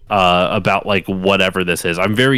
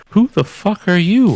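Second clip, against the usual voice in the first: higher-pitched, incredulous retold challenge; mock indignation; 10 semitones higher, 4 dB louder than usual. Comedian's thawed man confronts a PA, mock indignant. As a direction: act out a baffled demand, pitched up.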